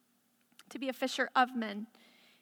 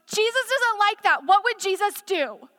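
mock preachy judgment; 7 semitones higher, 7 dB louder than usual. Mocking judgmental church talk.

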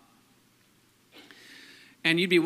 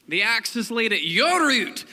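loud, raised Scottish John the Baptist; Scottish accent; 6 semitones higher, 6 dB louder than usual. Acted. Preacher reading John the Baptist's warning, loud Scottish accent. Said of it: "read John the Baptist in a Scottish accent".

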